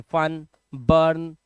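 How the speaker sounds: फन, बर्न said very slowly; slow demo. Shown as a demonstration. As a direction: draw out फन and बर्न word by word. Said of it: "बड़े आराम से". (फन, बर्न को धीरे धीरे खींचकर)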